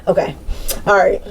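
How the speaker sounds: ordinary talk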